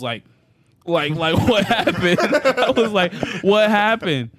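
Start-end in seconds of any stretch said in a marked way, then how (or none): 0.9-2.2 laughing through a high, loud what happened; mock-confused, laughing; dazed wake-up. Waking up after blacking out, laughing and high. 3.4-4.3 high and loud confused what happened; mock startled awake; dazed wake-up. Waking up dazed after the fall, high and loud.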